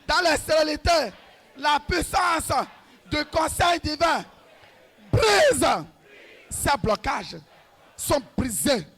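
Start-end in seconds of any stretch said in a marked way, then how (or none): 5.1-5.7 sudden shout. Real, not acted. Climax of the prayer.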